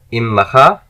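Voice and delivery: loud, clear model for students; repeat-after-me model. Shown as a demonstration. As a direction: project the word for the class to echo. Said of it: "just repeat after me".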